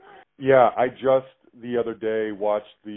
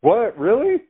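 high, loud disbelief; surprised; 11 semitones higher, 6 dB louder, slower than usual. Real, not acted. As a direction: react in delighted surprise. Surprised reaction to song pick.

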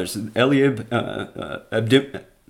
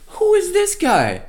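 incredulous. Acted. Preacher voicing David's outrage.

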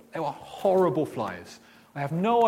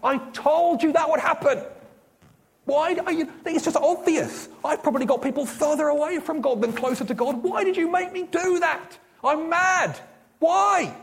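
much higher, louder angry outburst at God; furious rant; 12 semitones higher, 6 dB louder than usual. Retold or imitated. Teen shouting furiously at God after the flyers. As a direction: rage at God for the humiliation. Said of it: "And I went home furious".

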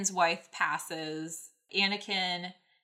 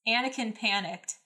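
ordinary delivery

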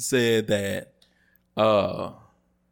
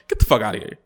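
ordinary delivery

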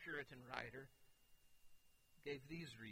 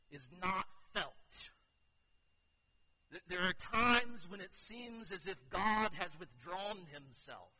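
louder, measured pulpit emphasis; emphatic preaching; 2 semitones higher, 7 dB louder than usual. Acted. Pastor describing God's silence, emphatic preaching.

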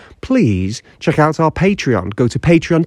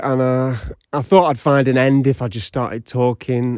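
usual speaking voice

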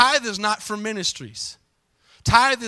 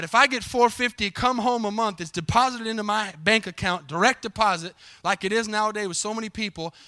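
normal talking voice

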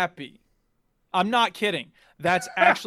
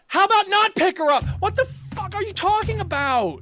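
exasperated outrage; 10 semitones higher than usual. Retold or imitated. Player refusing to pick up the treacherous friend, high exasperated protest. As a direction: yelp it back in comic outrage. Rejects the game's forced pickup.

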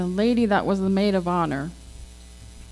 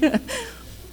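a quick squealing laugh, pitched way up; high burst of laughter; 14 semitones higher, 4 dB quieter than usual. Real, not acted. Laughs at her own story of the baby, a high squeal. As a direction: let a high giggle escape at the memory.

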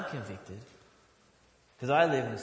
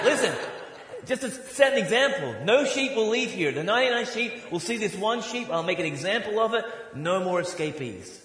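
mock tyrant shepherd; 5 semitones higher than usual. Pretend shepherd warning his flock, high mock menace. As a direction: lay down the law like a petty tyrant. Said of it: "And say".

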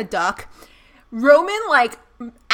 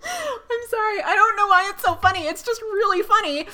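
laughing; 10 semitones higher than usual. Real, not acted.

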